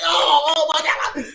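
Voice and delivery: mock-emotional wailing cry; hammed-up gushing; overexcited friends. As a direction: cry out like a thrilled buddy. Acting out the overexcited boys.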